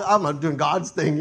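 loud, slow mock pious boast; mock his pious excuse slowly; mock self-righteous. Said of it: "he just tried to say".

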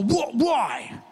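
high, combative imitation; defensive challenge. Bathroom cleaner challenged about his work, defensive. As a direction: fire back as a tough guy ready to fight. Max bristles at bathroom question, retold.

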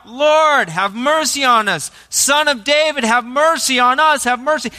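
high, loud plea for mercy; crying out. Acted. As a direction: call out urgently over a crowd. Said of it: "they cried out".

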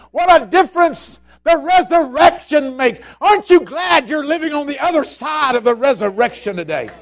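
high, loud jubilant preaching; preaching at full force. Acted. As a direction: rejoice over the resurrection.

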